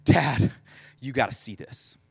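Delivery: mock-winded kid, low and softening; acted breathless report; out of breath. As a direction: gasp it out in pieces, imitating a breathless boy. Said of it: "they're, like, out of breath".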